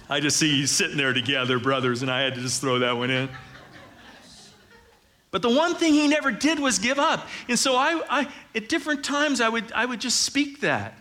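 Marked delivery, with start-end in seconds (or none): 5.3-11.0 high, loud preacher's push; impassioned preaching. Real, not acted. Pastor telling the congregation of Dad's perseverance, impassioned preaching.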